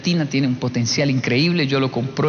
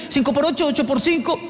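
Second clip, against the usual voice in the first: put-on higher, clueless child's answer; dim child answering; 8 semitones higher than usual. Niño que aprendió a golpes, high clueless answer. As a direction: chirp the wrong sum like a confused kid.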